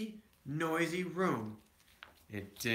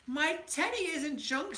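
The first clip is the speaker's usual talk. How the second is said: screamed; 13 semitones higher than usual. A narrator's reading. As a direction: cry out to save the teddy. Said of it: "screamed Sister".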